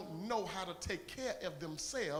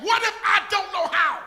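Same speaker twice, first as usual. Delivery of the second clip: pleading cry; 11 semitones higher, 15 dB louder than usual. Pretend.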